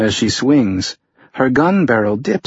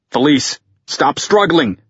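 louder, higher urgent call; 6 semitones higher, 3 dB louder than usual. A narrator's reading. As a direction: call across the ice. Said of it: "Tucker called".

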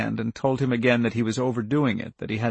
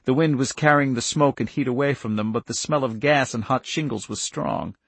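ordinary delivery, nothing marked

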